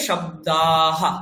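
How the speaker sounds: শব্দাহা slow and low, long দা then a short হা; sounded visarga. Shown as a demonstration. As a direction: hold the আ in শব্দাহা, then add a breath of হা. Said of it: "আয়ের পরে একটা হা". (বিসর্গ সহ টেনে শব্দাহা)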